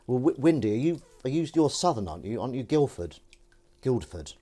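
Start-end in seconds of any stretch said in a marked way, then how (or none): none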